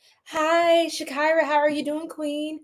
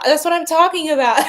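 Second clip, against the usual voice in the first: laughing; 5 semitones higher, 6 dB louder, faster than usual. Real, not acted. Excited card reaction, laughing.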